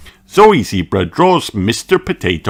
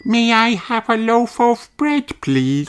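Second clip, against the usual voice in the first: put-on high kitten girl voice; sweet high cartoon cat; little cat voice; 13 semitones higher than usual.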